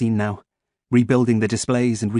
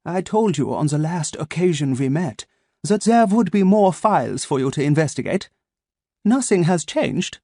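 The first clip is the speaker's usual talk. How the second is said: put-on higher, measured German accent; cool German-accented menace; German-accented android threatening the boy; 6 semitones higher than usual. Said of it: "spiced with a German accent".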